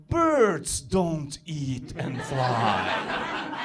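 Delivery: ordinary delivery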